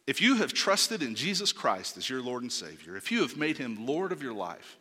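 normal talking voice

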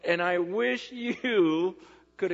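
loud, soaring pulpit delivery, slowing as it peaks; full-force preaching. Acted.

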